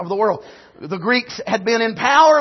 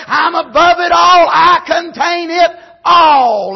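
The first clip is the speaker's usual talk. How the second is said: shouted preaching; 4 semitones higher, 5 dB louder than usual. Acted. Preacher voicing Jesus above all wisdom, full shout. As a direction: shout it from the pulpit.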